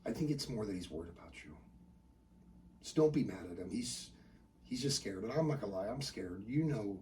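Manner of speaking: very soft, low confiding; hushed, tender reassurance; consoling mom. Acted. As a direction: gently admit worry to a child at night. Mom confiding her fear to son.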